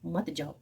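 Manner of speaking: मत जाओ low and soft as its own phrase; separate command. Shown as a demonstration. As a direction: set मत जाओ apart after the pause, voice dropping. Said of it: "वक्फ़े खफीफ है, ठहरना है".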